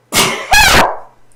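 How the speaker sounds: sharp cough after a sneeze. Real, not acted. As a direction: cough it out hard.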